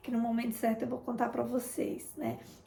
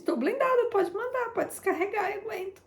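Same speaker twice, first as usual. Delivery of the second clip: much higher, bold mock-defiant retelling; defiant repeat; 10 semitones higher than usual. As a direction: throw it back gleefully, pitched way up. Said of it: "Porque eu tava em jejum". (desafiadora, bem aguda e animada)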